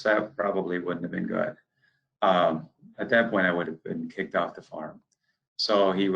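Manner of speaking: ordinary talk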